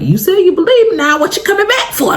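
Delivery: high, alarmed question; retelling fear at the officer's return, mock alarm; mock scared. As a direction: squeak it out like you're suddenly scared. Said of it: "I kinda got scared".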